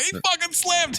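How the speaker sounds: high-pitched imitation of an indignant correction; comic high-pitched exclamation; mock excited outburst. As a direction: blurt it high, imitating a worked-up friend. Voices co-host's excited correction.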